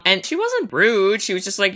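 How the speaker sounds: usual speaking voice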